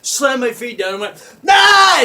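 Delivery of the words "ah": sudden high, loud yell; acted scare scream. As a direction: scream to startle someone. Acts out his own scare scream.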